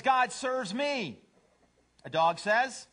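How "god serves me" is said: high, slow cat boast; self-centered cat. Acted. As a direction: proclaim it slowly, pitched way up. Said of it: "A cat says".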